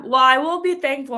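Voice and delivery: ordinary talk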